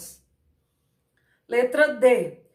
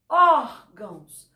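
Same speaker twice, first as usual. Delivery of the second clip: órgãos slowly, with the ó hit loud; órgãos, punching the first syllable ó; stress on Ó; 3 semitones lower, 4 dB louder, slower than usual. Shown as a demonstration. Teacher showing the class the strong ó in órgãos, slow and loud. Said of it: "o ó sai com maior intensidade".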